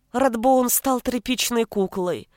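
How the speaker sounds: usual speaking voice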